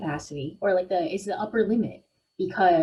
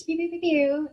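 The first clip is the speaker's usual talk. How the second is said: laser pew sounds; 8 semitones higher than usual. Acted. Laser sounds mid reading.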